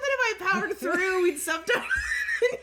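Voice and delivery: high, loud, squeaking through laughter; real laughing. Real, not acted. Cohost explaining how she powered through a flub, laughing. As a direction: explain it while helplessly cracking up. Explaining flubbed intro while cracking up.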